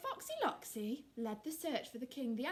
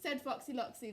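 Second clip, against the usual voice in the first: usual speaking voice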